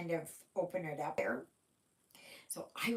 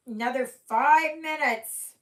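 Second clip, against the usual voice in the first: loud, high call across the room; 8 semitones higher, 12 dB louder than usual. Real, not acted. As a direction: call it out to someone away. Calling out to Mandy.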